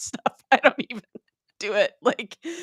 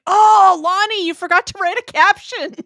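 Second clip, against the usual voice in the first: higher and louder, hammed-up surprise; mock surprise; 9 semitones higher, 10 dB louder than usual. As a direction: pop up high like you just spotted a mistake. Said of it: "and I was like".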